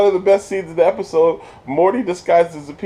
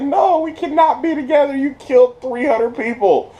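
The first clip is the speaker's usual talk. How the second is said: high mock upset Morty; 11 semitones higher than usual. Morty voiced breaking up.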